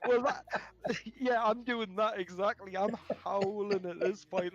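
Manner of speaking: high and loud through laughter; laughing through words. Real, not acted. Gamer admitting he's howling with laughter, laughing. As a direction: giggle the words out. Laughing while telling story.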